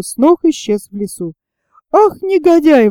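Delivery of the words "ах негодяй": higher, mildly indignant outburst; indignant exclamation. A narrator's reading. Sailor at the fleeing animal, mild indignant exclamation. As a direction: exclaim it with a touch of outrage. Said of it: "воскликнул Пенкроф".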